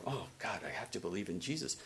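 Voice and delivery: mock offended mumble; offended grumble. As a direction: grumble it under your breath like a resentful churchgoer. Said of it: "some people would be really offended by that and go like".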